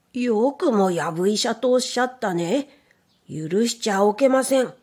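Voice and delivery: mild mock anger, rabbit doctor hitting back; indignant retort, understated; shouting back. A narrator's reading. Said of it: "と怒鳴り返しました".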